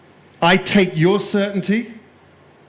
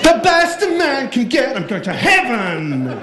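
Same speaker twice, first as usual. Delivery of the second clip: high, loud American hype; over-the-top American triumph; gung-ho American; 9 semitones higher, 3 dB louder than usual. Acted. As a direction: belt it like an unstoppable American. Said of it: "This is the American line".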